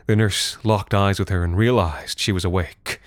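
plain reading voice

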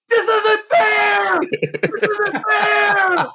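high, slow, mock wailing shout; mock screaming. Retelling the sore losers at the tent, high wailing mock shout. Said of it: "two dudes at the tent screaming".

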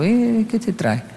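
slow, soft imitation of Mexican speech; Mexican accent. Dad eyeing his sneaky son, soft Mexican-accented suspicion. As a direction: drawl it lightly in a Mexican accent. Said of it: "Dicen en México".

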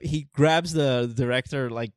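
usual speaking voice

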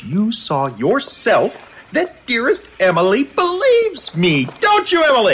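milk it as he wins the point; theatrical triumph. Acted. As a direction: reporter crowing to his editor that Emily believes him. Kolchak claims Emily believes him.

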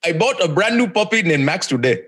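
Omos. Acted. Co-host asked to impersonate a wrestler, put-on accent. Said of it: "I wanna hear your almost".

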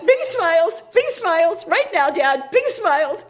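high, tense retold pleading; urgent coaxing. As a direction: coach dad through a panic, pitched high. Urgently coaching dad to smile on TV.

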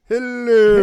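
high loud sitcom hello; Seinfeld hello. Shown as a demonstration. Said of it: "you kinda sound like you're in Seinfeld".

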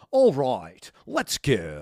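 loud, hammed-up arcade announcer; mock video game announcer. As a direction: boom it out like a dance game voiceover. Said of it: "the voiceover guy on the game".

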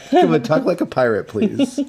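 high, whiny begging; pleading. Real, not acted. Begging co-host for a voice.

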